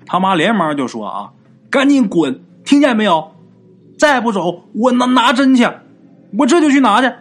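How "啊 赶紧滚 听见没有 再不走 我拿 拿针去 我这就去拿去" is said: loud, high-pitched scolding; forceful shouted ultimatum; angry threat. A narrator's reading. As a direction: act out a mother yelling threats. Mother threatens the possessing spirit.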